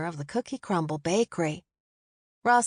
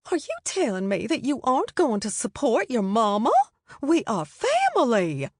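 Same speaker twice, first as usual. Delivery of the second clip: higher, rising into a louder scandalised question; a prim older lady clutching her pearls; fussy Southern matron; 5 semitones higher, 3 dB louder than usual. A narrator's reading. Mary Elizabeth voiced by narrator.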